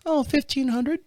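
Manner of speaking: put-on high, girlish rep voice; little girl on phone. Bank rep asking caller to verify, high little-girl voice. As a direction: rattle off verification in a girlish pitch. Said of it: "I get this little girl on the phone".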